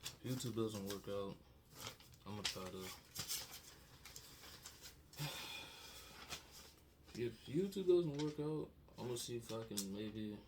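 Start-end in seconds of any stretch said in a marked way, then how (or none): 2.9-7.1 long breathy sigh through tears; long shaky sigh. Real, not acted. Heavy sigh while crying. 7.2-8.7 sobbing outburst. Real, not acted. Bursts out after sigh.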